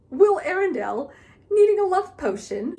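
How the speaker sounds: high, loud mock old ghost voice with a failed accent; attempted accent for Old Moll. Ghost mocking Will in a put-on accent. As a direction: scoff at him in a put-on accent. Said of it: "I can't do accents".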